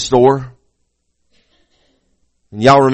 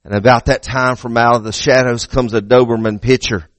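ordinary talk, nothing marked

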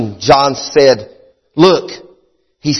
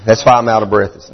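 laughing; 4 semitones lower, 3 dB quieter than usual. Real, not acted. Joking about fajitas.

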